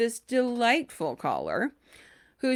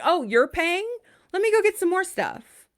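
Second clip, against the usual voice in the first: mock greedy customer; 11 semitones higher, 3 dB louder than usual. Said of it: "he was like".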